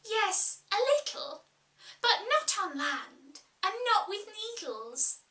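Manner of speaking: put-on high girl's voice, unsure; a polite child answering shyly; Alice, hesitant. Alice answering the Sheep, high and unsure.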